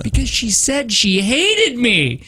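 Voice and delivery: put-on high, slow, sulky child voice; whiny kid. Child complains about her sister, in a whiny voice. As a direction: whine it out like an upset little kid. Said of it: "retelling the kids' fight".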